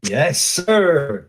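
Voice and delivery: ordinary talk, nothing marked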